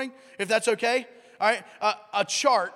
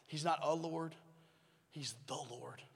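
normal talking voice

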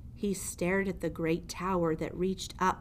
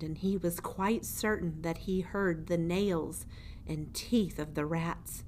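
plain reading voice

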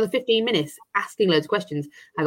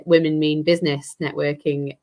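usual speaking voice

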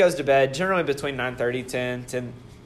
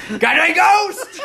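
mock shout; 13 semitones higher, 4 dB louder, much slower than usual. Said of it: "All I say is".

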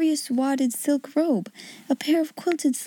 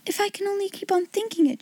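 cried out; 9 semitones higher, faster than usual. Acted. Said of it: "she cried".

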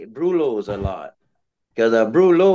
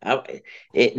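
ordinary delivery

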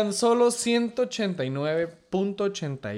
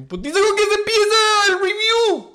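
high, loud hype announcer; announcer shout; 10 dB louder than usual. Acted. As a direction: call it out like a ring announcer. Hyped segment announcement.

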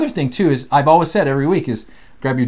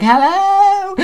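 much higher, louder called-out hello; loud greeting; 6 dB louder than usual. Retold or imitated. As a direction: shout it as if into an ear horn. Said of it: "everyone's like".

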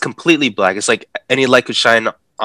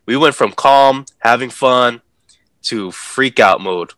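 normal talking voice

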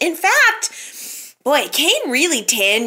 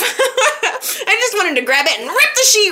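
giggling through the line; giggly, playful confession; laughing; 7 semitones higher than usual. Real, not acted. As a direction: laugh while you confess it. Laughing while joking.

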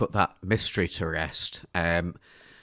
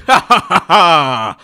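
loud, high maniacal cackle; mad scientist laugh; 7 semitones higher, 13 dB louder than usual. Acted. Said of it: "Victor laughed heartily".

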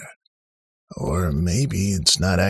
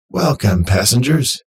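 loud, higher, upbeat machine voice; cheerful automated voice; 4 semitones higher, 6 dB louder than usual. A narrator's reading. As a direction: greet them like a chirpy robot host. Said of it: "an automated, cheerful voice said".

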